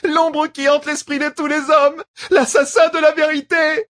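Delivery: high, loud terrified shout; terrified cry. A narrator's reading. Woman cries out in terror.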